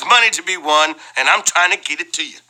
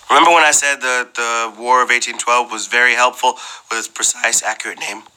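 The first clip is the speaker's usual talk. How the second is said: normal talking voice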